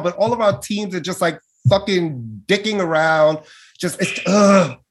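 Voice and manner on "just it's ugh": frustrated groan. Real, not acted. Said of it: "I'm so frustrated".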